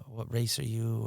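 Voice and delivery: ordinary talk, nothing marked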